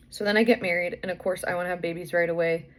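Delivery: usual speaking voice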